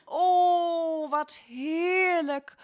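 high, slow drawn-out delight. Acted. Wife delighted at the food brought home. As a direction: savour the word heerlijk with joy.

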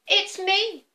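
high squeaky little goat; small high goat answer. Acted. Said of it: "said little Billy Goat Gruff".

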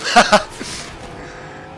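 sudden high, breathy cackle; mischievous high laughter; gleeful laugh. Real, not acted. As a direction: laugh at getting away with it. Gleeful getaway with the car.

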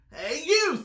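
high, loud challenge; pugnacious little ladybug; grouchy ladybug. A narrator's reading. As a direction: call out high like a grouchy bug picking a fight. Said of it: "said the grouchy ladybug".

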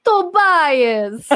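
long, high, booming cry of the name; hammed-up greeting call. Mother greeting Tobias, wildly hammed-up call. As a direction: belt it out in an exaggerated put-on voice.

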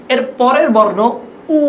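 normal talking voice

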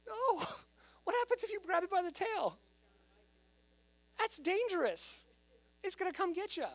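high-pitched, exasperated disbelief; comic high-voiced alarm; alarmed incredulity. Real, not acted. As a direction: warn them as if it's obvious madness. Incredulous warning about grabbing snake tail.

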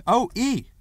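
high, loud, impatient name. A narrator's reading. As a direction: announce yourself impatiently through the door. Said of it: "he said impatiently".

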